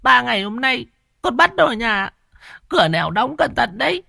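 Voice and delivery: a mother begging through sobs; wailing mother. Acted. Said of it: "Mụ Hoa mới mếu máo". (mụ Hoa mếu máo, kêu khóc van xin cụ Long)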